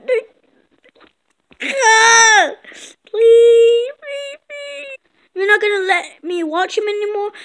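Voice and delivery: high, loud wailing please; hammed-up sobbing beg; crying plea. A kid begging his mom to keep watching, wailing. Kid begs not to lose videos.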